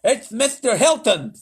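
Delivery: high, loud triumph; cried. A narrator's reading. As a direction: burst out with the answer. President's answer.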